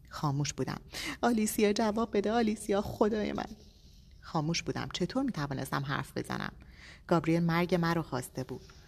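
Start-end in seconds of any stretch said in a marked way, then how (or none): 1.2-3.4 anguished pleading. A narrator's reading. Husband begging silent wife to answer.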